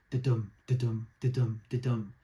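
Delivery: da-dum spoken like a heartbeat, even pulse; heartbeat rhythm. Shown as a demonstration. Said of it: "I could hear my own heartbeat".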